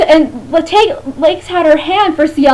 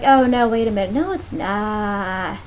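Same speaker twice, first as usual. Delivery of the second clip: mock dismay; 6 semitones lower, 8 dB quieter than usual. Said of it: "and it's like".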